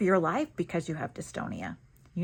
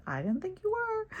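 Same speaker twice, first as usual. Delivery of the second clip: lightly reassuring; 14 semitones higher than usual. Retold or imitated. Reassuring Susan after her apology.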